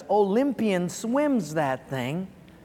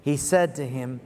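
slow, measured reading aloud; scripture reading; 4 semitones lower, slower than usual. Acted. Preacher reads the verse with congregation, slow scripture reading. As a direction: lead a room in reading, unhurried.

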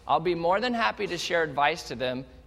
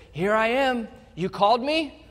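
put-on eager boy; 5 semitones higher than usual. Samuel runs to Eli again.

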